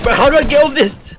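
loud, high frantic question; panicked shout. Real, not acted. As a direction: yell it out in a panic. Stuck, panicking in the game.

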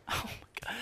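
barely voiced disbelief; stunned whisper. Real, not acted. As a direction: whisper it, lost for words. Stunned at being killed.

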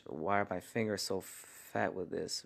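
usual speaking voice

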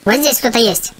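normal talking voice

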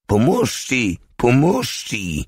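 high, drawn-out call for help; narrated cry of a wounded soldier; wounded man's cry. A narrator's reading. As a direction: call out high, volume held back. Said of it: "krzyczał ranny żołnierz".

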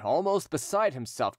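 ordinary delivery, nothing marked

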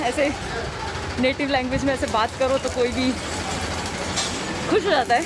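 muffled behind cloth mask; through a face mask. Real, not acted. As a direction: mask over mouth. Seen 2: Cloth mask covers mouth and nose throughout the labelled stretch.

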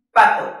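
"Pato" short, loud on "pa"; stress on PA. Shown as a demonstration. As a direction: hit the first syllable of "Pato". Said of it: "esta es la sílaba que más fuerte nos suena". ("Pato" corto, golpe fuerte en "pa")

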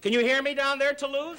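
calling down, high and loud; calling down a hallway. Acted. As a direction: call down to someone far below. Mocking Sonny's height.

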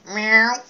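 high, loud meow; a squeaky cat meow; better cat noise. Shown as a demonstration. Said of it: "I can do a better cat noise".

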